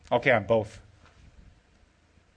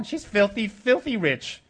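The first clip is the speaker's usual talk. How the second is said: ordinary talk, nothing marked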